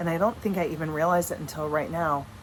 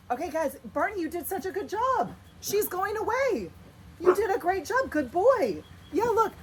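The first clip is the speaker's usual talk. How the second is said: praising the dogs; 12 semitones higher than usual. Real, not acted. Owner praising her dogs for guarding, high doggy praise voice. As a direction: gush over the dog like talking to a puppy.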